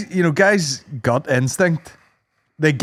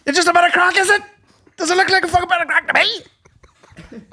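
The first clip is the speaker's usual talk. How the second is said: squeaky, pleading voice throughout; high whiny gimp; 14 semitones higher than usual. Acted. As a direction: play the wound-up gimp, shrill and desperate.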